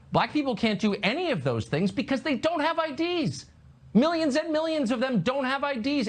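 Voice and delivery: high-pitched sarcastic lament; mock outrage.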